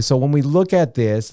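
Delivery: normal talking voice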